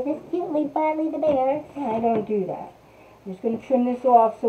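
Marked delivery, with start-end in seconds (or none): 0.0-1.6 cutesy gushing. Retold or imitated. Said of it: "I'm not gonna say".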